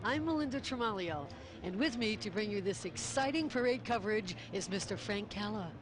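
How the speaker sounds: upbeat TV announcer voice; bright live-broadcast host read; parade commentator. Real, not acted. Hosts open live parade broadcast.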